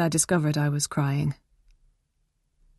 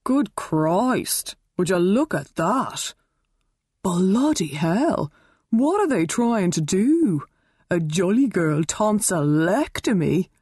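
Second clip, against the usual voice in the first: shocked; 2 semitones higher, slower than usual. Acted. Helen shocked at binoculars.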